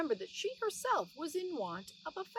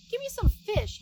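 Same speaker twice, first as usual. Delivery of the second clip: much higher, louder mouse voice; cross mouse husband demanding fish; Tom Thumb demanding; 10 semitones higher, 10 dB louder than usual. Acted. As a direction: bark the order like an angry little mouse.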